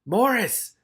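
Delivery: high, loud scream of Morris; a high cry for help; screaming a name. Acted. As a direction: scream his name in terror. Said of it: "Dangerous Beans screamed".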